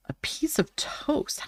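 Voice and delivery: breathy hushed whisper of the words; soft delighted whisper. Real, not acted. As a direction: whisper it like a secret treat. Savoring the toast drawing.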